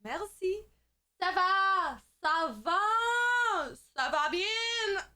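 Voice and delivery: high, stretched French greetings; over-extravagant French. Shown as a demonstration. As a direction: ham up the French, holding the vowels. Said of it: "over extravagantly speak when I speak French".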